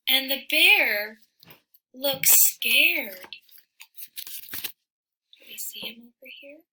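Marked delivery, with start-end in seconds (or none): 5.3-6.6 fast hushed whisper; quick whispered question to the listener. Real, not acted. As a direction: ask the little one in a whisper. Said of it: "whispered question to child".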